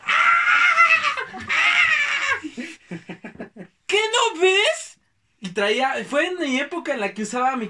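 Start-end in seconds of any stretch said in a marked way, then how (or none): 0.1-2.4 high loud mock wail with a laugh in it; wailing kid. Kid wailing after crash. 3.9-5.0 high, loud, slow scolding; the boy's family yelling at the teen; angry reproach. Retold or imitated. Family yells at him.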